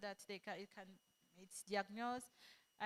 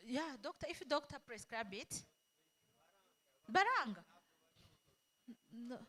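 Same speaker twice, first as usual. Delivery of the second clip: normal talking voice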